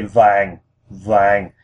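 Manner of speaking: vàng sinking downward each time; falling tone. Shown as a demonstration. Repeating gold for learners, each vàng falling. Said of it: "our voice goes down".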